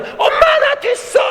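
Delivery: very high, loud, drawn-out squeal; excited shout. Retold or imitated. Daughter telling her mother she got in, ecstatic high shout. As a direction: shriek the news to Mom like an elated daughter.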